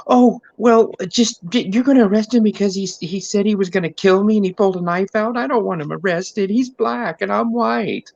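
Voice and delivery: high, whiny hammed-up sob; mock crying. Mocking the crying husband arguing with the cops, high whine. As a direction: squeak it out like a blubbering man. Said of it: "The soy boy starts crying".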